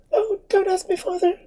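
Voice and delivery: very high, breathless frightened plea; panting, begging him not to ask; terrified girl gasping to the priest; gasping for breath. Acted. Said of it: "gasping for breath".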